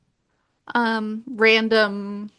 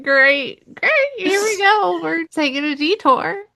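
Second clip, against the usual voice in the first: higher, louder mock enthusiasm; exasperated retold reaction; 10 semitones higher, 4 dB louder than usual. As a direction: act out a reader's exasperated "here we go". Acted exasperated reaction to the book.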